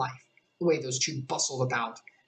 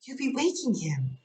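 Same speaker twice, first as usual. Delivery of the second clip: Alice afraid of waking the King; Alice hushing; 9 semitones higher than usual. Acted. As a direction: hush the twins like a worried girl. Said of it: "couldn't help saying".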